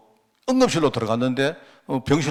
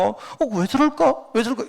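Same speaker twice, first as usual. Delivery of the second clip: mock-bewildered kid's voice, pitched way up; puzzled child; 10 semitones higher than usual. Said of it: "이랬다니까, 어릴 때 내가".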